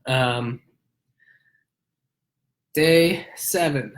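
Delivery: stuffy, nasal voice from a cold; nasal sick voice. Real, not acted. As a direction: talk through a blocked nose. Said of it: "as you can tell from my nasally voice".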